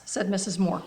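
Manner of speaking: ordinary delivery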